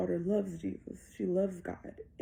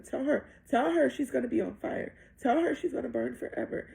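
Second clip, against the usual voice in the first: raised, higher insistent voice; retold urgent demand; 6 semitones higher, 6 dB louder than usual. Mother orders Satan to tell daughter the truth, urgent demand. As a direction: press hard, louder on each demand.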